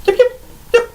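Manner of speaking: high, quick little kobold yips; yappy kobold creature voice; yipping kobold. Acted. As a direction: squeak it out like a tiny excited kobold.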